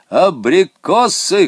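high, loud, drawn-out call; stretched-out eager yell; excited shout. A narrator's reading. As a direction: holler it like cargo being loaded. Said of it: "кричал Помидоров".

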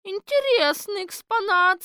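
mock the strange exhibit; sporty citizen woman. Гражданка Четверухина насмешливо. (насмешливо, высоким громким голосом)

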